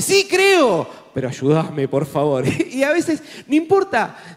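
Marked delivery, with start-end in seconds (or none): 0.0-0.9 high, louder cry of faith; desperate father. Retold or imitated. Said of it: "Y el papá dice". 1.1-2.4 lower, begging tone; pleading father. Retold or imitated. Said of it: "Y el papá dice".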